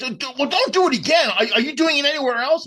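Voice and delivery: high, loud agitated scolding; mock-outraged high-pitched rant; pissed off. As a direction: imitate a rattled comic demanding answers, pitch way up.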